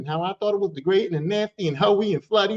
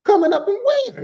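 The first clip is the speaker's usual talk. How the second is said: incredulous high question; 5 dB louder than usual. Retold or imitated. Incredulous reply repeated.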